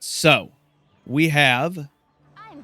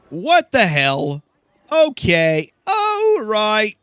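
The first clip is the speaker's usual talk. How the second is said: ordinary delivery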